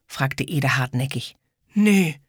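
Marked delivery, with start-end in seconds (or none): 1.7-2.2 unsure. Acted. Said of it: "machte der Junge, unsicher".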